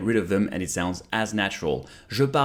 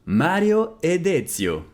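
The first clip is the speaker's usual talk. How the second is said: slow, careful, clearly spaced words; slow model repeat; 5 semitones higher, slower than usual. Shown as a demonstration. Teacher repeating Italian for students, slow and careful. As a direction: say it slowly for learners to copy. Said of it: "slow repeat of model".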